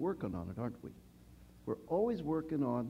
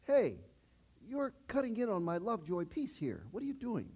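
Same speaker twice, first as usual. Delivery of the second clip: put-on a higher, whiny protest like a little kid; childish complaint; 7 semitones higher than usual. Young believer whining at people picking on him, childish. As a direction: pout like a four-year-old who lost his treat. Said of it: "probably very childish".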